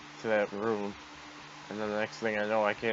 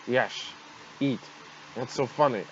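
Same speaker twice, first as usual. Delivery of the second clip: normal talking voice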